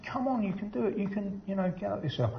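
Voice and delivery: imitated supporters' shouting. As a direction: egg a runner on in a high, hushed imitation shout. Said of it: "hear them actually shouting your name".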